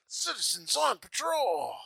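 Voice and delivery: slow, high, proud repeat; proud cop announcement. Retold or imitated. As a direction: announce it again like a dorky hero. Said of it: "he's like a sweet Chuck".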